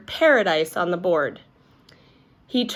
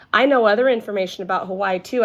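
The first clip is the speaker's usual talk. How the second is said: fast; 2 semitones higher, 3 dB louder, faster than usual. Acted. Eager to show off.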